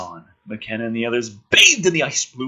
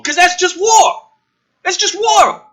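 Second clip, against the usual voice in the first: exasperated shout; 13 semitones higher, 7 dB louder than usual. Real, not acted. Reader ranting at the script, shouting. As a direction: shout that's just war, pitch way up.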